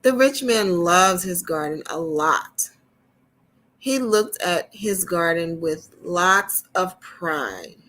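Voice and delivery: gentle storyteller reading to little ones; storybook reading. Acted. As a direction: read it like a picture book to kids. Reads storybook to children.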